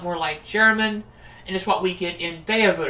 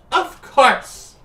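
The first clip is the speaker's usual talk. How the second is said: put-on bright magical girl; 5 semitones higher, 8 dB louder than usual. Character voice in game dialogue.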